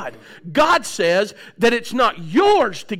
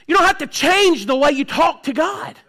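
usual speaking voice

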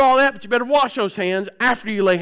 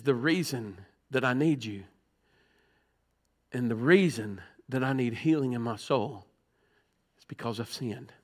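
much softer, lower, pleading prayer; hushed prayer; 11 semitones lower, 10 dB quieter than usual. Real, not acted. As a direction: let it sink to a near-hush. Quiet reflective preaching.

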